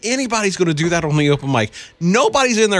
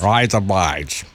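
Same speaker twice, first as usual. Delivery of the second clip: low, slow Australian attempt; imitated Australian accent; 7 semitones lower, much slower than usual. Shown as a demonstration. Co-host copying the cheek trick, low Australian accent. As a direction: drag it out in mock Australian. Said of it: "I want you to do this".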